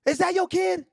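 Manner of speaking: high-pitched mock yell; imitated screaming woman. Screaming woman challenging the man staring at her, mock yell. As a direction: put on her confrontational shriek. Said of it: "she was screaming loud".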